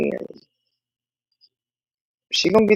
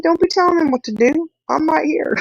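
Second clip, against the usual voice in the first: higher, mock-stern halt; mock high scold; 12 semitones higher than usual. Mom stopping bossy daughter by the Suburban, hammed-up high. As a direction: stop her in her tracks, hammed-up. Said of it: "I said".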